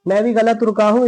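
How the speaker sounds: ordinary talk, nothing marked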